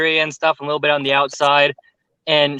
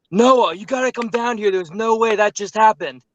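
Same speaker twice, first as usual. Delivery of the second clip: higher, urgent call from downstairs; stunned friend calling out, voice pitched up; alarmed call; 7 semitones higher than usual. Retold or imitated. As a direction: shout up for your friend to come see.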